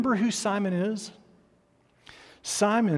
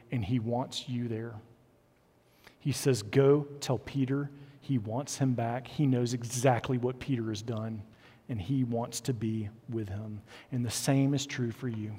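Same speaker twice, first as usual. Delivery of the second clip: soft, low, intimate assurance; tender reassurance; 4 semitones lower, 7 dB quieter than usual. Real, not acted. Preacher tenderly assures listeners of grace.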